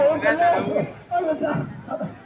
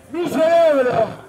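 slow, louder showman proclamation; dramatic announcement; 3 semitones higher, 4 dB louder, slower than usual. Real, not acted. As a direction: announce it grandly, slowly.

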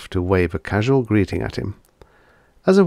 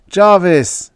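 loud high call into the dark; shouted name; 10 semitones higher, 8 dB louder than usual. Acted. Mark shouting after Jarvis in the ruins, a loud call. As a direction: yell his name after him.

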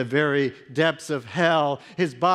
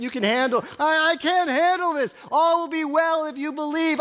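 building anguish; 8 semitones higher, 5 dB louder than usual. Acted. Father's anguish builds to outcry.

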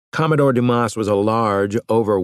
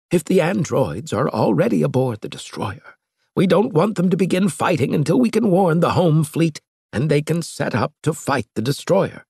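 lightly raised, grave scientist voice; slightly higher, earnest Ludendorff; Ludendorff's voice; 8 semitones higher than usual. A narrator's reading. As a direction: voice the old professor's warning a touch higher. Ludendorff explains plan.

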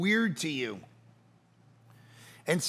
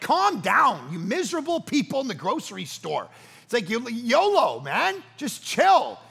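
loud, higher, exasperated chill-out plea; mock scolding; 5 semitones higher, 4 dB louder than usual. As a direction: stretch it out, telling off grumpy shoppers. Mock scolding of grumpy shoppers.

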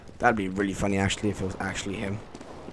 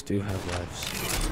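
low, very slow, drawn-out "Do have lives"; dramatic low echo; 4 semitones lower, 7 dB quieter, much slower than usual. Shown as a demonstration. Mocking his own claim to viewers, in a low slow drawl. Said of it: "Gamers do have lives".

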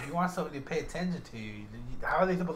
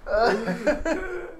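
cracking up, higher and much louder; real burst of laughter; laughing; 9 semitones higher, 14 dB louder, much slower than usual. Real, not acted. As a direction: say it through helpless laughter. Cracks up at the mock line.

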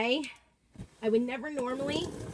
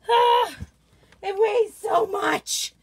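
high, slow shout of effort; loud strained complaint; 6 semitones higher, 8 dB louder, slower than usual. Real, not acted. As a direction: cry out under a heavy load. Straining to lift heavy box.

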